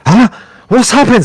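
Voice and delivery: high, loud startled cry; sudden alarm; shocked confusion. Acted. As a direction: Sergei startled as his fiancée collapses. NPC shocked as wife falls.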